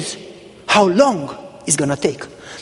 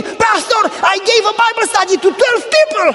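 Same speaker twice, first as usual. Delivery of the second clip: overjoyed shout; 13 semitones higher than usual. Retold or imitated. Woman phoning her pastor with the news, overjoyed high shout. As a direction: burst out with joyful news, much higher.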